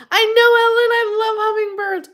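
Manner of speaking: high, loud, delighted reply; high, delighted gush to Ellen. Real, not acted. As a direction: beam it out to a friend. Gushing reply to a viewer.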